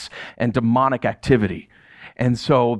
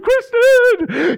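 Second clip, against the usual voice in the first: high, loud excited call to his wife; excited shout; 5 dB louder than usual. Retold or imitated. Pastor runs inside to tell his wife the news, excited shout. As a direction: yell her name from the doorway, thrilled. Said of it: "so excited".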